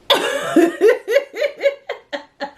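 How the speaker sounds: long, high-pitched burst of laughter; real high giggling fit; laughing. Real, not acted. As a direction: crack up in a high staccato laugh.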